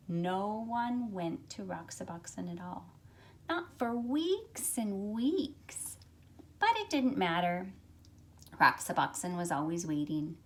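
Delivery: put-on lively, expressive read-aloud for children; storybook reading. A narrator's reading. Teacher reads picture book aloud.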